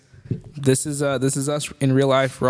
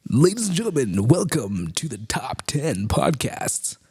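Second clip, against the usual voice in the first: fighting game announcer; slower than usual. Shown as a demonstration. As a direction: stretch it out like an arena announcer. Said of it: "that cool announcer voice".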